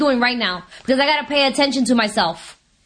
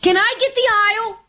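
mock panic; 9 semitones higher than usual. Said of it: "I was like".

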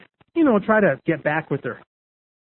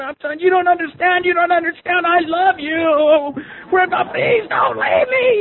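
screaming plea, reenacted; 11 semitones higher than usual. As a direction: reenact frantic begging, stretching into a cry. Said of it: "I'm screaming and yelling".

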